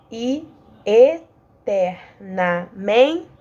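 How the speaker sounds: "E eternamente" stretched out very slowly; slow scansion. Shown as a demonstration. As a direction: drag the syllables out to count them. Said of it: "Agora pra outra". ("E eternamente" bem devagar)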